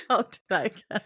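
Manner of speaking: loud, laughing. Real, not acted. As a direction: chuckle through it. Laughing while talking.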